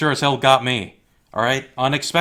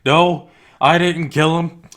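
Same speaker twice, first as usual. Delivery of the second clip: higher, louder panicky excuse; hammed-up frantic denial; mock guilty denial; 4 semitones higher, 5 dB louder than usual. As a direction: play a killer whining that it wasn't his fault. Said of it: "and he's like".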